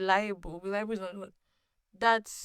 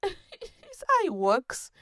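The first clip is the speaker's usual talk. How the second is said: laughing; 10 semitones higher, much slower than usual. Real, not acted. Laughing at her own story.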